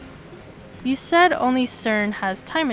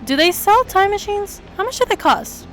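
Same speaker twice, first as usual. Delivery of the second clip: eager forum poster; 8 semitones higher, 5 dB louder than usual. Acted.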